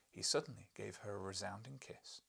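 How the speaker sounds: plain reading voice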